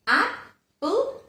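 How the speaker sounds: "Apple" broken as ap, pul, pitched high; split into syllables. Shown as a demonstration. Teacher breaks apple into two parts, syllable by syllable. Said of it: "broken into two different parts".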